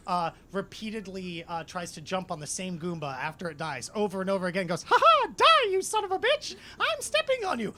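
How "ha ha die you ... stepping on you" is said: very high, loud cartoon squeal; Walt Disney. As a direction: manic falsetto cackle, out for blood. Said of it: "you watch as Walt Disney".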